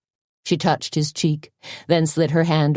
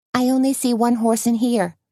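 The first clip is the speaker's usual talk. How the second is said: higher, lightly voiced friend; a higher lilt for Tony; Tony's voice; 8 semitones higher than usual. A narrator's reading.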